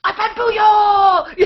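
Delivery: high, loud, slow shout of the threat, nearly crying with laughter; mock priest shout. Retelling how the priests yell at students, a hammed-up shout. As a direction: bellow it out slowly like an angry priest. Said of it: "they go".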